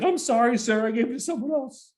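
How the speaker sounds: high-pitched imitation of a flustered stewardess; apologetic stewardess. Stewardess apologizes to yelling passenger, high apologetic voice. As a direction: plead an apology in a high mock voice.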